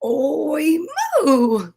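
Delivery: drawn-out "Oi" sliding into a moo; a botched oink turning into moo; pig attempting oink. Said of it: "tried to go oink".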